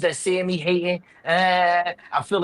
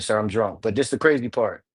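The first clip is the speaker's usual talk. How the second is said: ordinary delivery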